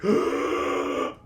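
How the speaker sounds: sudden loud gasp and splutter; big gasp. Acted. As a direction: gasp hard, as if just coming to. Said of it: "she takes, like, a big".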